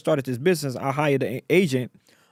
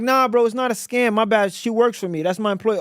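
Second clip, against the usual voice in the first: retold reassurance; 7 semitones higher, 3 dB louder than usual. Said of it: "I'm like".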